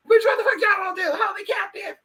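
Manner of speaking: high, squealing mock outrage; outraged shout. Confronting the imagined plotters, hammed-up outraged shout. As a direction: holler it in over-the-top disbelief. Same challenge repeated, escalated to shout.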